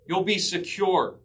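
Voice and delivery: usual speaking voice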